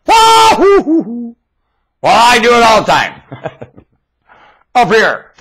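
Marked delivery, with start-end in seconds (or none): 0.1-1.4 high, slow, loud hooting "wah-hoo"; Pluto's whoop. Retold or imitated. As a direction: whoop like a planet on a joyride. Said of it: "You ever hear Pluto go".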